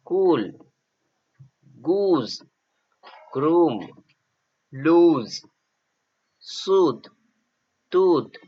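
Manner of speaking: each word said clearly on its own, rising then falling; slow model reading of English words for learners; teacher drilling vocabulary aloud; reading a word list. Shown as a demonstration. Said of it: "असा उच्चार होणारे शब्द".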